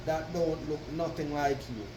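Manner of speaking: normal talking voice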